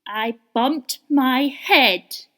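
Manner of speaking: loud, very slow sulky complaint; cross little voice. A narrator's reading. Little rabbit sulking up from the well, cross and slow. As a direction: grumble it out crossly. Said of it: "A rather cross little voice answered".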